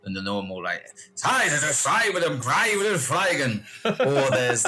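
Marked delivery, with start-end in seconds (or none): 1.2-3.6 hammed-up German accent. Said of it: "two types of German accent".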